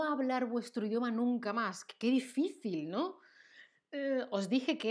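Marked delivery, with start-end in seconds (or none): none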